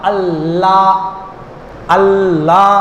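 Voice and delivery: আল্লাহ with the double L held against the palate; slow আল্লাহ, lingering on the L, then a long vowel; tongue held on L. Shown as a demonstration. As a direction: press and hold the L in আল্লাহ. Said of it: "জিহ্বাটা একটু লাগে".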